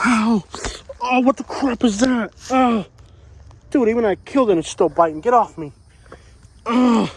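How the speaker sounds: high, alarmed cries as something bites; startled yelps of pain. Real, not acted. Swatting a horsefly that keeps biting him, high pained yelps. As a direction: yelp and swat at a biting fly, pitched well up.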